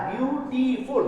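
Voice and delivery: "বিউটিফুল" slowly, as biu-ti-ful; broken in three. Shown as a demonstration. Teacher showing a trisyllable, slow syllable breaks. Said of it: "তিনটা ভাঙা বা ট্রাইসিলেবল".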